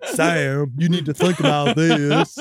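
slow, caricatured Southern drawl; badly faked sweet Southern voice. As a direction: draw it out in a cartoon Southern accent. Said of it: "how to do the sweet Southern voice".

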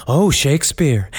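low, slow mock British thespian accent; mock British actor. American actor mocking British rivals at pilot season, mock British accent. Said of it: "all the British actors, they're like".

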